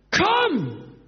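very high, drawn-out call. Acted. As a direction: summon him across the water. Acts Jesus calling Petrus. (hoch und gedehnt herbeirufen)

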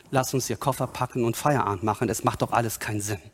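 low, quick defeatist mumble; resigned quitter. Acted. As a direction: give up and pack it in. Acts out giving up.

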